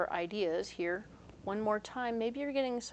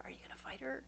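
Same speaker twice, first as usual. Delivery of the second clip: whispered, excited gossip; 5 semitones higher, 10 dB quieter, faster than usual. Retold or imitated. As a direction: hiss it like schoolkids gossiping. Kids whispering repeated question.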